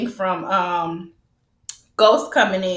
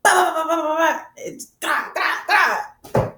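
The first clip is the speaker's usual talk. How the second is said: high rattling gun noises; imitated gunfire; 5 semitones higher than usual. Retelling Proctor being shot, mimicked gunfire. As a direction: mimic a burst, then separate cracking shots.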